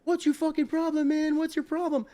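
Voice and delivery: high-pitched imitation of yelling bartenders; mock angry yelling. Bartenders turning on a customer, mock angry yelling. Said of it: "all the bartenders start yelling at me".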